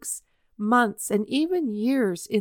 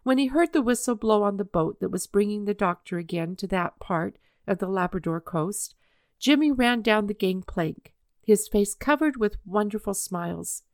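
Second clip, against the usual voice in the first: plain reading voice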